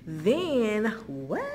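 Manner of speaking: loud, rising drawn-out disbelief; playful amazement. Pretend. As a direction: play up the thrill of a lucky find. Mock amazement at a store find.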